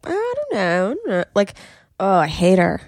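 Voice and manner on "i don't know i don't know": high, mock-innocent squeak. Friend mocks Zara playing dumb, high innocent squeak. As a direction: play dumb in a high little voice. Mocking Zara dropping bombs.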